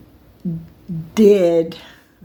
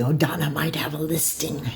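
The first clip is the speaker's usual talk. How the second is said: hushed, conspiratorial whisper; mock whisper of a tip-off about a vacant condo; imitating a greedy whisper; 3 semitones lower, 6 dB quieter than usual. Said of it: "I didn't want to sound like I was greedy".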